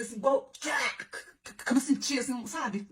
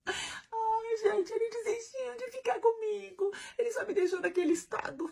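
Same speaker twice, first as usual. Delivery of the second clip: much higher slow fake whimper; mock crying; 11 semitones higher, slower than usual. Telling viewers he gave up on her, slow hammed-up whimpering wail. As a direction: wail it like a jilted tragic heroine. Mock lament about being abandoned.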